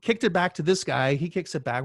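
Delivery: ordinary talk, nothing marked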